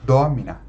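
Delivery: Domina with the stress on DO; stress on the first syllable. Shown as a demonstration. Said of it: "A única palavra proparoxítona é domina". (Domina com tônica em DO)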